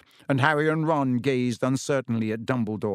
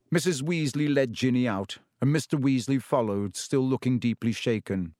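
normal talking voice

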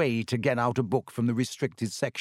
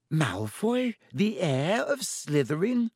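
higher, drawn-out doubtful question; skeptical; 6 semitones higher, slower than usual. A narrator's reading. Girl doubting her friend's suspect, skeptical. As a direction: question it slowly, pitched up in disbelief. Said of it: "said Hermione skeptically".

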